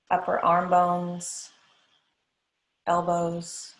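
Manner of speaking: even, unhurried body-scan voice, long pauses; guided meditation. Acted. Yoga teacher guiding the class through a body scan, guided meditation. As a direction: lead them through the body phrase by phrase, leaving space to breathe.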